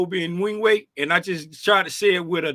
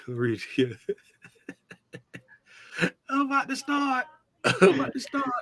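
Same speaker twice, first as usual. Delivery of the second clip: laughing; 7 semitones higher, 4 dB quieter than usual. Real, not acted. Laughs while reading the poll.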